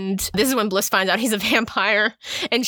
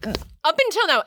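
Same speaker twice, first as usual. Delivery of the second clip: ordinary delivery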